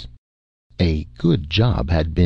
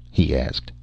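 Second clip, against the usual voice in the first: plain reading voice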